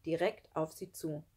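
usual speaking voice

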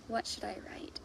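hushed, softly wondering to herself; thinking whisper. Acted. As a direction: whisper like a secret idea coming. Pondering the first page.

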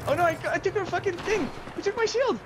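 panicked yell. Real, not acted. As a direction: shriek in sudden dismay. Panicked over the lost shield.

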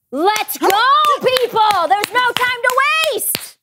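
loud, high urgent call; urgent shout. Acted. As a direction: hurry everyone along, shouting. Abigail urging servants to hurry.

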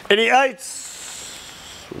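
high, drawn-out call to the room; slow, high-pitched roll call. Real, not acted. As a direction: call for hands, stretching the last word. Calling for a show of hands.

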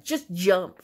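brief, firm push; urging. A narrator's reading. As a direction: press a hesitant friend to act. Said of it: "I urged him".

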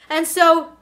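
usual speaking voice

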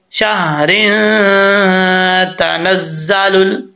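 शहरिन तनज़्ज़लुल joined, the नून sounded, slow; recited demo. Shown as a demonstration. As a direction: slowly link शहरिन into तनज़्ज़लुल. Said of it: "कि कैसे पढ़ेंगे". (शहरिन तनज़्ज़लुल मिलाकर धीरे से)

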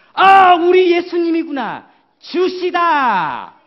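high, loud, drawn-out cry of recognition; joyful recognition. Acted. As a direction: act out amazed recognition, stretching the words. Acts John recognising the risen Jesus.

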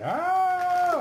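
sudden high shriek; imitating a shocked scream. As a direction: shriek like the woman on the package. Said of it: "The woman's all like".

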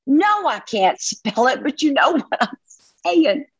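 high, slowed retort; mock exasperated reply. Wife answering husband's request to spell, mock exasperation. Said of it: "he's constantly saying, "Honey, could you spell that".

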